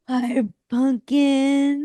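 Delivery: slow, drawn-out cooing hello; dog-greeting coo. Retold or imitated. Greeting a passing dog, cooing baby talk.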